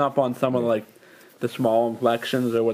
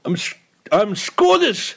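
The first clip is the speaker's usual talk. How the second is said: high, slow, shaky attempt at Scottish; badly faked Scottish, pitched up; Scottish accent; 7 semitones higher, slower than usual. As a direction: have a clumsy go at a Scottish accent. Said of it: "Scottish accent".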